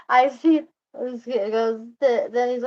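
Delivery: normal talking voice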